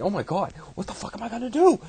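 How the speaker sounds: high, loud, frantic wail; panicked god. Acted. Ra panicking about his rampaging daughter, high, loud and frantic. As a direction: squeal it like he's lost control.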